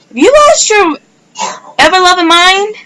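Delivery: stunned, are-you-serious tone; outraged shock at the news; shocked disbelief. Retold or imitated.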